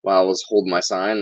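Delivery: ordinary talk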